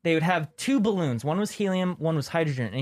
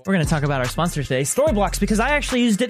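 louder, upbeat sponsor pitch; ad read; 5 dB louder than usual. Acted. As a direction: sell it like a YouTube ad spot.

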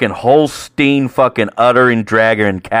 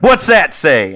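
high, loud mock demand; imitated irate challenge; confrontational; 7 semitones higher, 5 dB louder, slower than usual. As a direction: demand it like an angry customer. Angry husband confronts the server.

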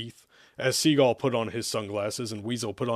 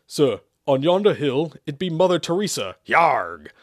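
high, loud hammed-up pirate; 5 semitones higher, 8 dB louder than usual. A narrator's reading. Pirate informs the king of the attacker, piratical. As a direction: report like a cartoon pirate. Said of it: "a pirate informed the king".

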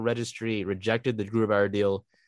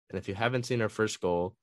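usual speaking voice

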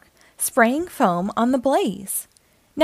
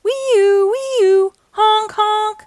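high, sustained siren wail, then honks; imitated siren and horn; 10 semitones higher, 5 dB louder, much slower than usual. A narrator's reading. Fire truck races off to a fire, loud siren imitation.

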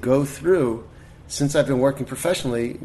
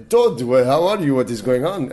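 Israeli accent imitation; 6 dB louder than usual. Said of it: "and he talked like this".

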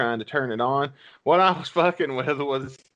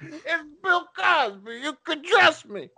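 Bill Cosby impression; 14 semitones higher than usual. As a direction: purr it out falsely trustworthy. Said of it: "my Bill Cosby impression".

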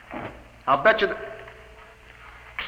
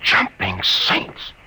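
awed gasp; 8 semitones lower, 4 dB louder, much slower than usual. Acted. Reaction to vanished taxi.

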